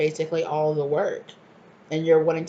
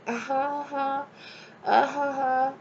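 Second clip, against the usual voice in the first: sarcastic uh-huh; 8 semitones higher, much slower than usual. Retold or imitated. Sarcastic reply to liar.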